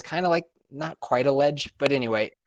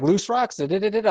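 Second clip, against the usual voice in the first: mock panicky lady; 8 semitones higher than usual. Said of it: "she's panicking".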